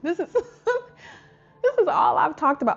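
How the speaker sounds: slow, high, half laughing, half crying; overcome, laughing and tearing up; laughing through tears. Real, not acted. Showing viewers her result, laughing through tears.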